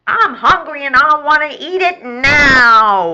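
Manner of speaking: high, loud whine; whining. Acted. Hungry rat demands his meal at once, whining. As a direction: wail the last word like a spoilt child. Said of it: "whines Swanson".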